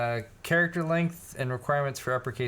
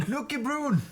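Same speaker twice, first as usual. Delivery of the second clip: squeaky high mock Scottish "Nookie Broon"; playful fake Scottish accent; 13 semitones higher, slower than usual. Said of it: "I wish I could do a good Scottish accent".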